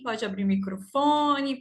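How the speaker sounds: normal talking voice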